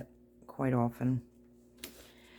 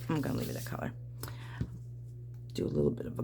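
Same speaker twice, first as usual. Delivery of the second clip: whisper; 8 dB quieter, much faster than usual. Real, not acted. Continues the whispering.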